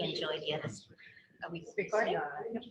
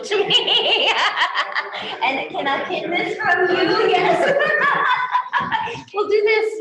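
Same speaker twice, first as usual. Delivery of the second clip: laughing higher and louder while fumbling with the mic; 11 semitones higher, 10 dB louder, slower than usual. Real, not acted. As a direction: giggle through the words, pitch up. Host laughing while pinning mic.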